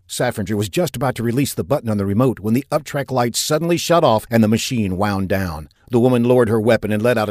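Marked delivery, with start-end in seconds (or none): none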